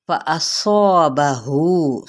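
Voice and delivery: drawn out, chanted recitation of the word; slow Quran recitation. Shown as a demonstration. Teacher reciting a Quran word for students, slow and chanted. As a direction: recite it slowly and melodically, stretching the vowels.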